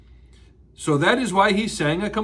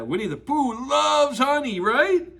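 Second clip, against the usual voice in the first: plain reading voice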